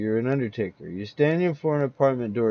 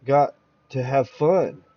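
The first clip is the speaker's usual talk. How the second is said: usual speaking voice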